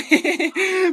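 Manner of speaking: a sudden high burst of laughter; real giggle at the memory; a quick high cackle. Real, not acted. Laughs at his own story.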